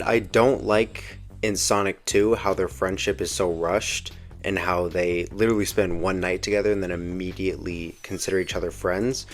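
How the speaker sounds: ordinary delivery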